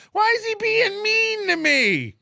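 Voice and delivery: high, whiny imitation of the guy; mock his self-pity in a high whine; whiny mock victim. Streamer mocking a threatening guy, high whiny voice. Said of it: "and you're like".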